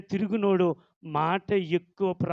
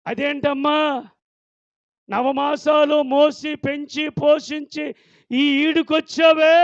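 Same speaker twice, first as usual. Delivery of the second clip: high, slow building to a shout; angry scolding; 8 semitones higher, 6 dB louder than usual. Retold or imitated. Parents scolding their daughter, building to a shout. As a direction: scold her, rising to a yell.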